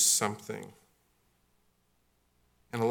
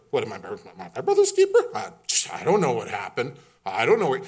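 evasive Cain; 6 dB louder than usual. Acted.

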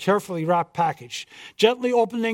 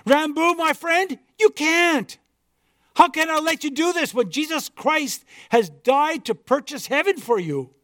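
pleading missionary; 8 semitones higher than usual. Acted.

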